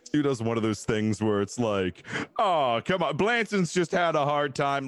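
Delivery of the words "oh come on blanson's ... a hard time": mock pleading. Mimicking manipulative pity plea in story.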